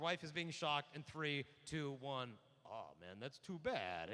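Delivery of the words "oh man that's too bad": mock indifference. Acted unfeeling husband reacts to shock.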